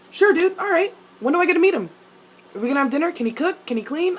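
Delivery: high, eager run of questions; eager accepting parent. Acted. Parent hearing of a son's boyfriend, eagerly accepting. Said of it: "Like me, I'd be like".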